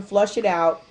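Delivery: ordinary talk, nothing marked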